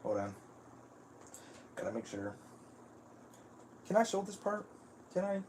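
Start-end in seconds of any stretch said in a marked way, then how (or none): 3.9-5.4 pitched way up, asking himself; a wary high check; unsure high question. Real, not acted. Streamer unsure whether he may show this, asking high. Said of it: "asks himself about showing".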